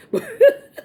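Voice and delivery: sudden loud high laugh. Real, not acted. Joking about getting the bad instructor twice, a loud high laugh. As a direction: burst out laughing.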